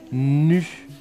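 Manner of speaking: "nu" with a lengthened n; slow, stretched "nu"; drawn-out syllable. Shown as a demonstration. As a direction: hold the n before the vowel in "nu". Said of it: "la lettre qui fait ce bruit-là".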